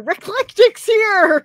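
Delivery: high, loud, slow call of delight; thrilled shout of welcome; excited announcement. Real, not acted. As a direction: cheer that a friend just arrived. Excited greeting to arriving viewer.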